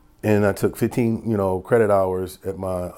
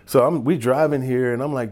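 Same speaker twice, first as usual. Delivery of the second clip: ordinary delivery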